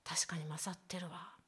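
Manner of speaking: breathy inner-voice whisper; whispered thought. Retold or imitated. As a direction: whisper it to yourself.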